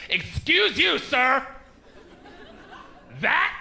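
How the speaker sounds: high-pitched offended woman; indignant confrontation. Acted. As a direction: get in his face, scandalized. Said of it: "She just gets in my face and goes".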